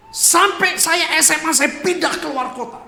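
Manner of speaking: loud, high, emphatic preaching; impassioned cry from the pulpit; impassioned outburst. Real, not acted. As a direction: raise the voice, stretching out the words. Climax of painful testimony.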